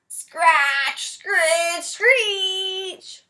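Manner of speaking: high, loud drawn-out screech; mock screeching noise. At night a noise wakes the boy, loud screech. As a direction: screech like nails on glass.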